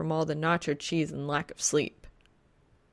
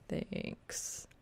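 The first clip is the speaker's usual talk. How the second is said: muttered thanks; 13 dB quieter than usual. A narrator's reading. Bemused mumble to silent stranger.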